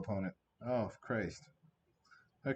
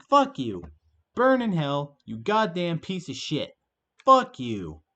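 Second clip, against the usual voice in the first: loud, higher, all-out tantrum; furious shouting; 6 semitones higher, 8 dB louder than usual. Real, not acted. Streamer raging at the opponent's luck, loud furious yelling. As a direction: yell it, spitting every curse.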